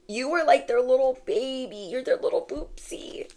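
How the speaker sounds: breathy cooing whisper; whispered baby talk. Pretend. Reader coos to viewer as their baby, whispering.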